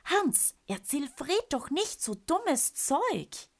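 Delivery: high, slow scolding wife. Acted. Aunt scolds uncle over scary stories, high and slow. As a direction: tell him off, pitched high.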